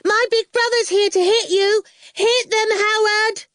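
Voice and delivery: high, loud schoolgirl yell; child shouting. A narrator's reading. Little sister yells at angry girls, high shout. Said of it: "Awful was shouting".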